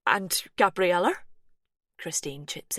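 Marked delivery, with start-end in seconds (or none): 0.1-1.3 urgent. Acted. Christine chips in urgently.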